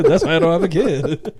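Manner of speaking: high loud laugh into words. Real, not acted. Laughing at the burn.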